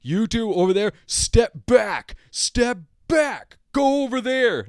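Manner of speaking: mock an irritated clerk ordering people back; mad clerk. Clerk ordering the two people behind him to step back, in a mock angry voice. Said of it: "He was already mad at them".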